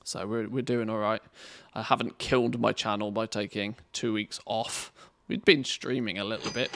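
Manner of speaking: ordinary talk, nothing marked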